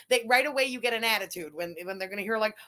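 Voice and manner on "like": ordinary talk, nothing marked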